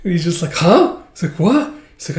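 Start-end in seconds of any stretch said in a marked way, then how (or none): 0.5-1.0 confused huh. Acted. Boy confused by sudden confession. 1.4-1.7 startled what. Acted. Boy confused by sudden confession.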